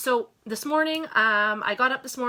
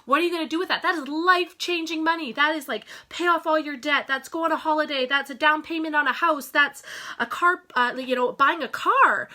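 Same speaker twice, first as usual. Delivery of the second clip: higher and louder, gushing over the prize money; excited radio host; 6 semitones higher, 5 dB louder than usual. Retold or imitated. Radio host congratulating a prize winner, excitedly gushing. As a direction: hype it up like a radio host handing out a big win. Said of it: "the radio host said".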